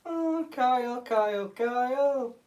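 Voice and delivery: high teasing singsong, dragging the name out; singsong calling. Acted. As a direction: call "Kyle" like a playground taunt.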